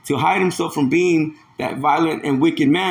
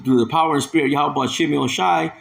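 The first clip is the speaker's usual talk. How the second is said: usual speaking voice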